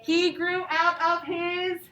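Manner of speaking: slow, clear storybook reading; patient read-aloud to young pupils; reading to children. A narrator's reading. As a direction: read it out slowly for the class.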